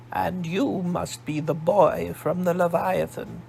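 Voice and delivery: put-on slow, stately posh English accent, older lady; a grand English matron sizing up the boy. Old woman greeting the boy in a posh English accent. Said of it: "her English accent as posh as Zavin's".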